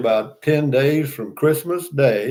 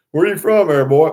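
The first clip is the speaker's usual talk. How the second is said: higher, louder hammed-up good-old-boy call; mock country local; 4 semitones higher, 7 dB louder than usual. Mock local asking a stranger where he's from, louder and higher. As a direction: bellow it like a small-town local sizing up a stranger.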